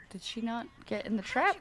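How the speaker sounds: usual speaking voice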